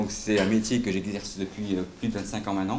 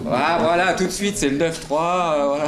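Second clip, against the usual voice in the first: louder and higher, delighted the audience got it; excited confirmation; 6 semitones higher, 6 dB louder than usual. Real, not acted. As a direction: exclaim it like a quiz host hearing the right answer. Speaker excitedly confirms audience answer. (ravi, plus fort et plus aigu, comme un animateur qui reçoit la bonne réponse)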